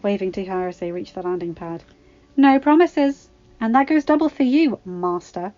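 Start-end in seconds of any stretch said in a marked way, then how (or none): 2.4-5.5 teasing Ahsoka. A narrator's reading. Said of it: "she replied".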